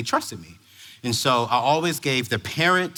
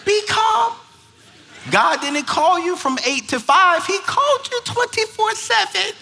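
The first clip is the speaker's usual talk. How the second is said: high, strained pep talk; hammed-up reminder to stay calm; self-talk; 14 semitones higher than usual. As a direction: squeal it at yourself, barely holding it in. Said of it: "I gotta remind myself".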